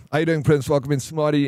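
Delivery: ordinary talk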